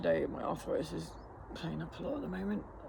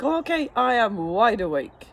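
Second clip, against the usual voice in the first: startled; 6 semitones higher, 8 dB louder than usual. Retold or imitated. Acting out the sudden jolt awake.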